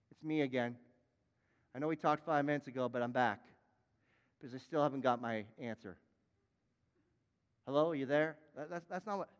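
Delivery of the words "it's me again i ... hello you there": low, offhand mock prayer; casual calling. Preacher mimicking someone nagging God for an answer, casual and low. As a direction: check in casually, keeping it low. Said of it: "that's not what it means to call".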